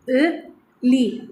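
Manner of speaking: अ short then ली long and slow; short and long vowel. Shown as a demonstration. Teacher demonstrates the difference between अ and ली, slowly. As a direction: contrast अ and ली slowly. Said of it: "इन ॿिन्ही में difference आहे".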